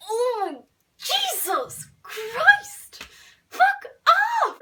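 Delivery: drawn-out, high-pitched yell; hammed-up scream of frustration, very loud; exasperated outburst. Girl fed up with being followed, exaggerated exasperated yell. Acts out exploding at Jane.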